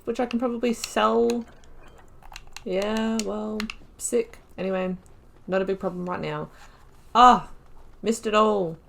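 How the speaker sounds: ordinary talk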